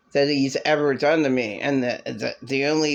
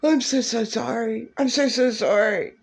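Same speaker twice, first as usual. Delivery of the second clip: high, sing-song mock sorry; mocking apology; 6 semitones higher than usual. Mocking the ex's repeated sorry, high. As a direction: parrot the apology with scorn. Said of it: "that's not an apology".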